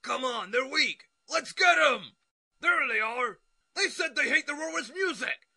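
high, fired-up mob shout; rallying battle cry. Acted. Leader urging mob onto weak enemies, high rallying yell. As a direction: whip up the crowd to charge, voice high.

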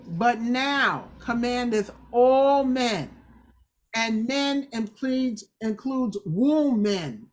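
slow, with "all" hit hard in "commandeth all men"; slow preacher emphasis leaning on "all"; slow emphatic stress. Real, not acted. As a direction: lean on "all" in "commandeth all men" and drag the phrase. Preacher stresses scripture phrase.

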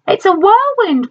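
high alarmed cry of a farm wife; cried out. A narrator's reading. Aunt Em crying out as the wind howls. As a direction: shout the warning up high. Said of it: "cried Aunt Em".

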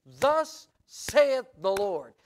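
booming and very slow, each word hammered out; thundering proclamation. Acted. Preacher voicing Paul's declaration to the church, thundering. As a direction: proclaim it like the voice of God. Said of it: "I came saying".

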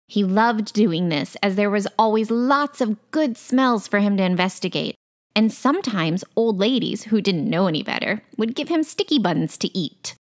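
ordinary delivery

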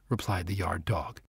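plain reading voice

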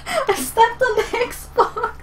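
slow, breaking into laughter; laughing retelling. Real, not acted. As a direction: say it through giggles. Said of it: "My brother just pulled out the old Xbox".

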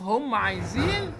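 normal talking voice

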